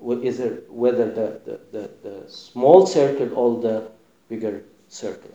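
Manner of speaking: ordinary talk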